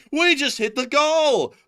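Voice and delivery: high, loud cheer as the goal is hit; a real burst of joy; excited shout. Real, not acted. Streamer cheering to his co-host as the goal is hit, excited shout. Fundraiser goal just reached live.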